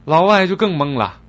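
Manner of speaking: usual speaking voice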